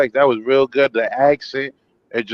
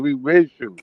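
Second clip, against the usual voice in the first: ordinary talk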